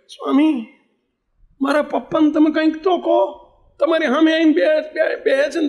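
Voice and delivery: put-on high pleading child's voice; child crying. Boy pleading with swami about parents, high and tearful. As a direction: beg like a sobbing little boy. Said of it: "રડતો જાય ને બોલતો જાય".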